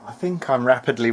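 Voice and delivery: ordinary delivery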